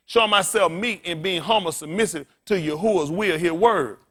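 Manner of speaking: forceful preaching. Real, not acted. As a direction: drive the point home to the congregation.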